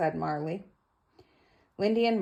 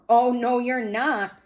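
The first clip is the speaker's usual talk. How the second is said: higher, louder no; loud refusal; 6 semitones higher, 7 dB louder than usual. Acted. George refusing the doctor at the door, loud no. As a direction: bark the refusal.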